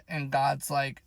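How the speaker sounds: ordinary talk, nothing marked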